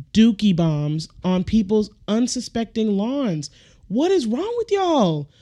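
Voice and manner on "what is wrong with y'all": high, incredulous question; incredulous high disgust; disgusted outburst. Real, not acted. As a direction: shoot the pitch up in disbelief.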